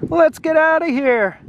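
put-on children want to flee the dragon, high frightened cry.

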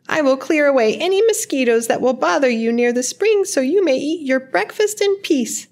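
high, squeaky bat voice; little bat. A narrator's reading. Brown bat asks a girl for shelter from rain, high-pitched. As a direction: pipe it up high like a small flying creature.

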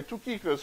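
Tychicus with oo in the first syllable, not eye; Greek pronunciation. Shown as a demonstration. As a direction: say Tychicus the Greek way, too-KEE-koos. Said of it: "she actually said it the, the right way".

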